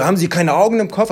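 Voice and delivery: high, loud mock insult; imitated angry shouting. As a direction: act out a shop worker snapping at a customer. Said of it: "hat wirklich geschrien".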